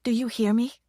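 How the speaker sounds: pressing, insistent question; urgent. A narrator's reading. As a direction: push hard for an answer. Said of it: "her voice becoming more urgent".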